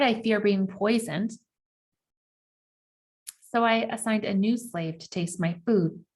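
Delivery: plain reading voice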